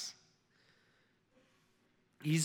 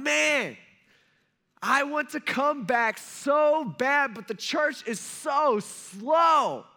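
loud, high, drawn-out complaint; exasperated God; 8 semitones higher, 6 dB louder, much slower than usual. Acted. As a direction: shout it slowly, dragging out the key words. Voicing an impatient God on throne.

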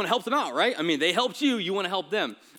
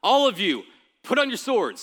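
louder, higher call to arms; rallying command; 5 semitones higher, 5 dB louder than usual. Acted. David ordering his men to arm, loud command. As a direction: bark the order to the troops. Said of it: "so here we go, ready?".

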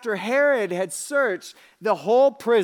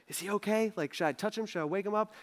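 soft, questioning parent's voice; hushed worry; 8 dB quieter, faster than usual. Acted. Father checking on his wrapped-up baby, softly worried. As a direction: worry quietly over a sleeper.

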